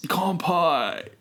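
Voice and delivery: laid-back, high surfer drawl; surfer dude voice. Pretend. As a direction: play a chill beach surfer. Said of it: "I don't like your surfer voice".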